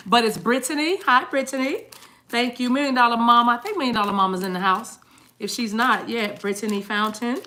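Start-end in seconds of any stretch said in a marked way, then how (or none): none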